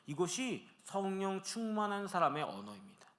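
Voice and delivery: ordinary delivery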